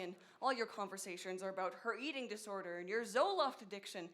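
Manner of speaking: ordinary talk, nothing marked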